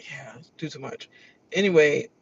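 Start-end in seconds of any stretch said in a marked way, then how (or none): 0.1-1.1 breathy whisper; whispered aside. Real, not acted. Muttering a dig at Felicia, whispered. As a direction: whisper it under your breath like a secret dig.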